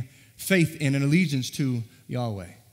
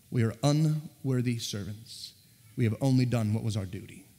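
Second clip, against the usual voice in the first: humble, soft confession; 5 semitones lower, 4 dB quieter than usual. Pretend. Quoting Jesus' humble servant words.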